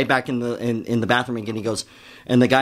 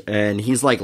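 ordinary delivery

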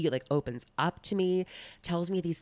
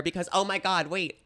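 ordinary delivery

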